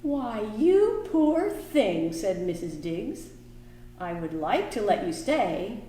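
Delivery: lively picture book reading; reading to children. A narrator's reading. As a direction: read it warmly to the kids.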